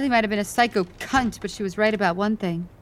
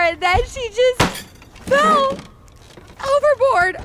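high, escalating panicky account; frantic retelling; slower than usual. Acted. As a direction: work yourself into a frenzy. Hysterically retelling a fight at sea.

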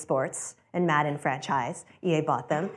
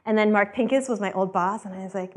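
ordinary talk, nothing marked

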